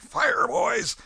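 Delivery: loud, breathy call to fire; shouted order. Retold or imitated. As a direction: bark the command to shoot. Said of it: "I sung out".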